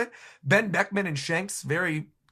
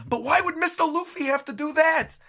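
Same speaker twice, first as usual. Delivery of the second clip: Gambia screaming; 11 semitones higher, 3 dB louder than usual. Acted. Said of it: "screaming in the background going".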